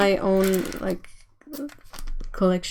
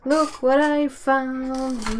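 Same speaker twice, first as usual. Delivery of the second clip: cooing; 4 semitones higher, much slower than usual. Real, not acted. Showing the kitten, cooing.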